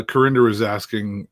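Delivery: normal talking voice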